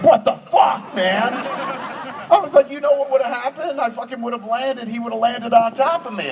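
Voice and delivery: high-pitched mock outrage; outraged protest. Shaken actor confronts stunt coordinator, imitated high-pitched outrage. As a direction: protest in an agitated, squeaky-high retelling. Retold outburst at coordinator after fall.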